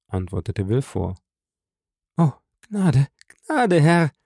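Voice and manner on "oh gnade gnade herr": high, desperate woman's plea; acted terrified begging; begging for mercy. A narrator's reading. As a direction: plead with the judge, voice raised in pitch. Wife begging husband for mercy.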